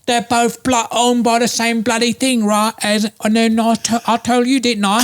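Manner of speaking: put-on high, lively British accent; Russell Brand impression. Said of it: "Russell Brand saying it".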